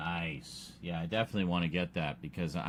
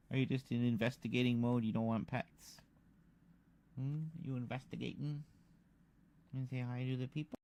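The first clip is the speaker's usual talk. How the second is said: soft pet voice; 4 semitones higher, 10 dB quieter than usual. Real, not acted. Talking to the cat.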